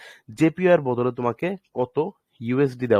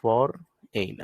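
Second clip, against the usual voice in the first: Foreign stretched long and slow; foreign drawn out slowly with a falling tune; drawn-out word; much slower than usual. Shown as a demonstration. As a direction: hold foreign out so students catch it. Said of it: "For বলতে আমরা বুঝি foreign".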